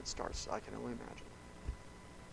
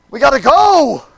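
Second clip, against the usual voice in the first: high, loud frantic shout; desperate father yelling to leave; urgent shout; 13 semitones higher, 22 dB louder than usual. Acted. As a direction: hurry him along, panicked. Jairus urging Jesus.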